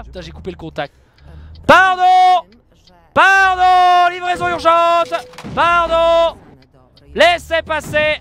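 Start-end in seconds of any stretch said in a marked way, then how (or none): 1.7-8.2 loud, high calls to clear the road; mock urgent calling out. Trucker yelling at traffic to clear the way, loud and urgent. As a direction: play the pushy delivery driver shouting his way through.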